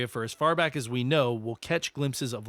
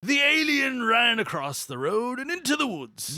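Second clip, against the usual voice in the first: put-on high, loud old prospector voice; cranky old-timer, high and loud; 11 semitones higher, 3 dB louder than usual. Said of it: "I went from the old prospector".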